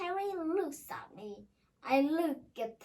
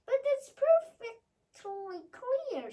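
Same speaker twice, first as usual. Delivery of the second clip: bright insisting; 10 semitones higher, 6 dB louder than usual. Acted. Fortune teller insists.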